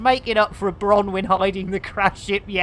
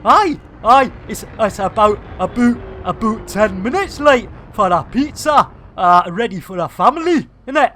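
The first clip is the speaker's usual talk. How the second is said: a lightly put-on Welsh accent drifting off, louder; 5 dB louder than usual. Streamer jokes to viewers in a wobbly Welsh accent. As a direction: try a Welsh lilt and let it slip. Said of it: "your Welsh accent drops back into, uh, the old Indian".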